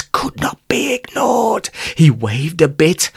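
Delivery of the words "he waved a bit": ordinary delivery, nothing marked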